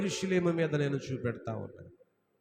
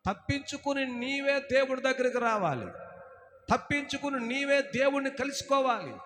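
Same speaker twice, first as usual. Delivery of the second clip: full-force preaching; 7 semitones higher than usual. Acted. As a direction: proclaim it from the pulpit. Preacher exhorts, pitch raised. (గట్టిగా బోధించే స్వరం)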